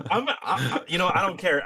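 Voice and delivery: high laughing words, real giggle. Real, not acted. Laughing about crazy movie plots.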